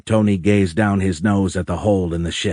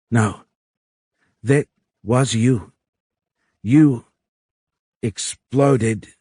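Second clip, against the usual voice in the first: higher-pitched, halting alien accusation; 4 semitones higher, much slower than usual. A narrator's reading. Alien corrects Tony about the bomb, halting. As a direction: separate each word while searching for the next.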